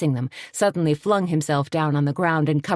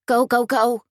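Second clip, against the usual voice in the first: higher, louder urging; urgent prodding; 8 semitones higher, 4 dB louder than usual. A narrator's reading. Tony urging Suzanne on down the highway, prodding. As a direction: push her to keep going. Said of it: "Tony prodded".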